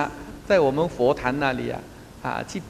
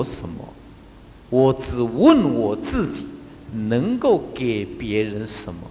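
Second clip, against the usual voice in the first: 慢慢念出格言; 4 semitones lower, slower than usual. Shown as a demonstration. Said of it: "星云大师有讲过一句话".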